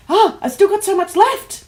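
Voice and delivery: high, loud burst of delight; thrilled mock exclamation, high-pitched; excited kid exclaiming. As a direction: act out a gasping, thrilled schoolkid. Child delighted at leftover toffee.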